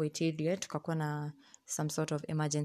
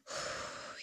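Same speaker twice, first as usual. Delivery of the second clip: a heavy sigh to let the anger go; real sigh before calming down; long exasperated sigh; 12 dB quieter than usual. Real, not acted. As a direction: breathe out hard through the mouth, fed up. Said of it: "Let me calm down".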